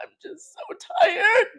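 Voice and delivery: a whispered build into a hammed-up wail; mock weary sigh. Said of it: "but it was like".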